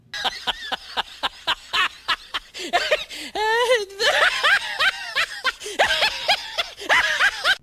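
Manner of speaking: loud, high howling laugh that spills into tears; huge laugh. Real, not acted. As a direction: laugh until you cry. Storyteller bursts out laughing.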